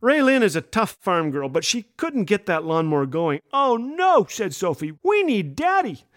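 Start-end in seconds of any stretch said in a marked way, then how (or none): 3.5-4.3 put-on high little-girl oh no; toddler dismay. Toddler watching mom fail with the mower, lightly voiced dismay. 5.0-6.0 put-on high little-kid voice calling for Daddy; be a two-year-old sure Daddy fixes it; toddler plea. Toddler telling mom to get Daddy, high and earnest.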